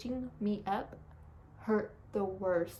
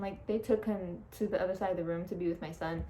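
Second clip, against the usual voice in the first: ordinary delivery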